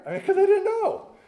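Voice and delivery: bursting into a laugh, pitched high; a real laugh breaking through the words. Real, not acted. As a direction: laugh it out, amused at the joke. Laughs at own joke.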